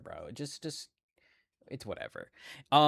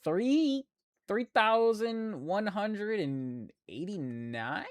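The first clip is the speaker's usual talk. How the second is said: surprised reading; 8 semitones higher, much slower than usual. Real, not acted. Squinting at small numbers.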